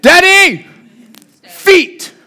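high, loud "Daddy, feet"; toddler demanding. Retold or imitated. Toddler son orders resting dad back on his feet, loud. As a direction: order dad up like a two-year-old. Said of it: "and he's like".

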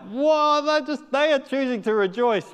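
high, loud joyful whoa; the two women marvel aloud, high and loud; amazed exclaim. Retold or imitated. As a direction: cry out in delighted wonder.

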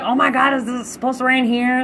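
thrilled at the thought of rain; retold giddy excitement; excited. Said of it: "And I was like".

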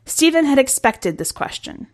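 plain reading voice

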